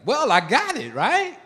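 high, exasperated "got it, right?" swooping up; tossing it off impatiently, way up high. Retold or imitated. Preacher recalling his inner impatience at the old man, high and exasperated. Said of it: "in my mind, I was like".

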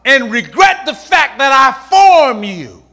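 high, loud, slow cry of I'm sorry; shouted apology. Acted. Preacher voicing God, crying out sorry. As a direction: shout it out, stretched and pained.

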